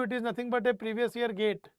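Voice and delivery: usual speaking voice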